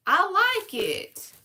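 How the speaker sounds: high, loud and slow delight; excited. Real, not acted. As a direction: savor it with glee. Delighted reaction to card.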